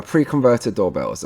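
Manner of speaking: normal talking voice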